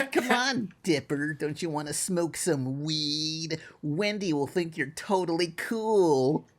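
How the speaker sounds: slow, coaxing cartoon voice; Mabel impression. Cartoon sister tempting her brother, slow coaxing impression. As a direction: draw out the peer pressure in a put-on voice. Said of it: "but Mabel smoking crack is wrong".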